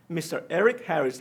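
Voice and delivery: ordinary talk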